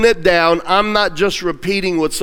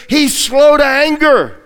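emphatic; 8 semitones higher, 4 dB louder than usual. Acted. Preacher emphasizes God's patience.

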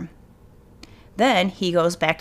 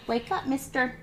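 gentle wake-up call; 6 semitones higher, 9 dB quieter than usual. Real, not acted. Waking snoring dog gently.

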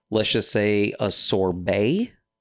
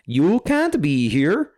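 high, slow, stern official voice; a hammed-up guard turning someone away; mock border guard; 7 semitones higher, 3 dB louder, slower than usual. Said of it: "Did they just be like".

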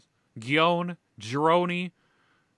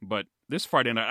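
ordinary talk, nothing marked

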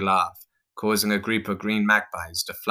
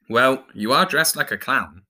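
higher-pitched, louder defensive retort; 6 semitones higher, 5 dB louder than usual. A narrator's reading. Pirate argues with clown about her outfit, defensive. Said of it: "Jake argued while shrugging defensively".

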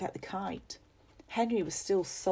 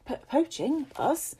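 high, startled stammer; surprised; 9 semitones higher than usual. Acted. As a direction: caught off guard, protest innocence. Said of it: "his eyes grew wide with surprise".

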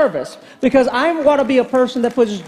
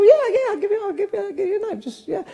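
much higher, fading softer; eager; 10 semitones higher, 4 dB quieter, faster than usual. Retold or imitated. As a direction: gush the offer, trailing off. Offering free knife eagerly.

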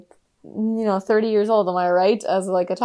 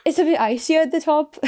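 put-on Cho Chang, Irish-like accent; 7 semitones higher than usual. Quoting the film girl's warning, imitated Irish-like accent. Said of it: "I think it's Irish accent".

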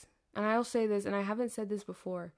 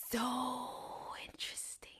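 dramatic whisper; 4 semitones higher, 10 dB quieter, slower than usual. Real, not acted. Dramatic emphasis.

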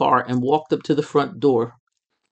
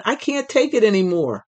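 impatient plea; 8 semitones higher than usual. A narrator's reading. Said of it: "Not able to withstand the suspense".

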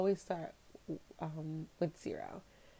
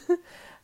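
high nervous laugh; 13 semitones higher, 9 dB louder than usual. Real, not acted. Nervous laugh in retold panic.

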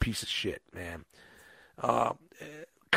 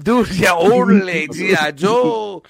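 higher, louder, slowed mock worker; mock Mexican accent; 5 semitones higher, 5 dB louder, slower than usual. Mimicking workers seeing his toys, mock Mexican accent. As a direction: stretch out G I Joe amazed. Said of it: "imitating workers".

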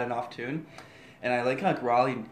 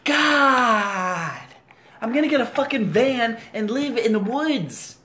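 loud, higher hammed-up outburst; mock tantrum; 10 semitones higher, 9 dB louder, slower than usual. Told she sounds like mother, hammed-up tantrum. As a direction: throw a fake teenage fit.

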